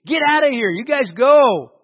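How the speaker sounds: loud, pleading push to get them out; a desperate king shouting at them to go, slowing down on the last words; Pharaoh begging. Retold or imitated. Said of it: "He begs them to leave".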